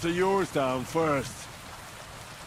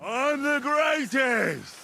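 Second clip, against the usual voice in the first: put-on drunken boastful shout; 5 semitones higher, much slower than usual. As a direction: bellow it slowly, proud and drunk. Drunk yells on the ledge.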